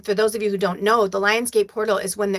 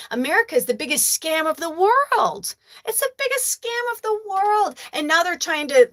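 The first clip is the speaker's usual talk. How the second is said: heated rant; 7 semitones higher than usual. Real, not acted. Ranting about America.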